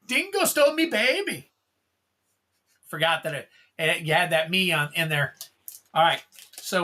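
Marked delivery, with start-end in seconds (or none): 0.1-1.5 high, hammed-up Australian with "me baby" and stress on "Dingo"; mock Australian accent. Remembering the joke card while opening a pack, mock Australian accent.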